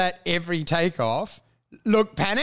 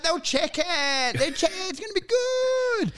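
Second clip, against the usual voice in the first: protesting; 10 semitones higher than usual. Retold or imitated. Young prankster defends himself to family, high protesting. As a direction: plead it like a kid in trouble.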